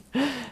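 brief high giggle; a quick self-amused laugh; short laugh. Real, not acted. Laughing at own accent.

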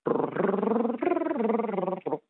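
slow buzzing brrr trill; singer's trill warm-up. Shown as a demonstration. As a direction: roll the R like a jazz warm-up. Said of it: "a technique for singers to warm up".

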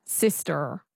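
American Sister with a final r; standard American. Shown as a demonstration. Learner echoing the coach, standard American accent. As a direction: copy a rhotic American Sister. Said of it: "So that's the American".

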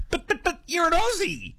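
high-pitched mock protest; protesting commenters. Host voicing stunned commenters about an actress's accent, high mock protest. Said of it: "the comments are awash with".

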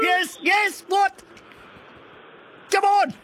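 high, eager shouts of encouragement; excited urging. Real, not acted. Radio host urging a quiz caller to answer, excited shouting. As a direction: egg the caller on, thrilled.

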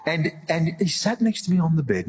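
amused, laughter spilling into the words; laughing. Real, not acted. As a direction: carry on the story while still laughing. Still laughing while retelling the dream.

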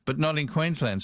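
ordinary talk

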